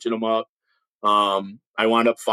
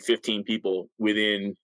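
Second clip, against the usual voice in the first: ordinary talk, nothing marked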